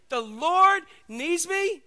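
very slow, high, loud wonder; awed disbelief. Acted. Donkey repeating the news to himself, slow and awed. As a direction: draw out each word in awe.

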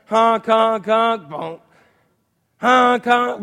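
loud mock honking noise; hammed-up alarm honks; imitated alarm honks. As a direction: honk like a snooze alarm.

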